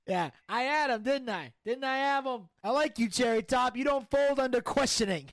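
brash gangster bragging and griping; cocky mob boss. Acted. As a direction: play a swaggering mafia don. Plays mobster Tony D in skit.

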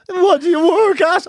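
high, slow, drawn-out official question; mock young policeman, every word stretched; young guard. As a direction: imitate a youthful guard trying to sound official. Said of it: "they're so young".

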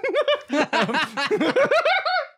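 real delighted howl of laughter; big real laugh. Real, not acted. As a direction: crack up at the pen fight. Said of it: "That's Jason Bourne".

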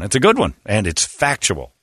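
ordinary talk, nothing marked

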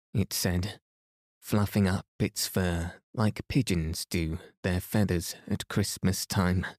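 ordinary delivery

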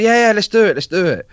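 a keen, bright yes, yes, let's do it; eager yes. Retold or imitated. Former manager eagerly agreeing to the interview on the phone, excited. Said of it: "he was like".

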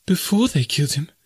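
high-pitched, appalled question; horrified disbelief, pitched high; horrified echo. A narrator's reading. Jane repeats his words in horror.

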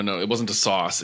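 ordinary delivery

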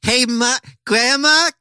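put-on high squeaky little kid asking for money; high childish plea to grandma. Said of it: "I don't know what, what voice that is".

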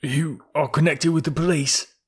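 fearful question. A narrator's reading. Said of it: "Victor shuddered as he said".

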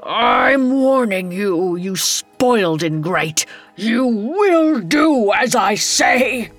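put-on enraged old king. Dying king commanding his defiant son, enraged. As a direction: lay down the law in a drawn-out fury.

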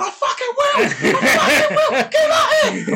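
very high-pitched strained scream through laughter. Retold or imitated. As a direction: scream a challenge, re-enacting it. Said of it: "I start screaming at the camera".

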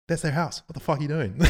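scolding while laughing. Retold or imitated. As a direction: scold him through a laugh. Cop scolding the guy.

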